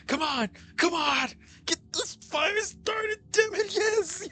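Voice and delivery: very high, crying wail, slow; mock crying plea. Player begging gods for fire, crying very high. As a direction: wail the plea on the edge of tears.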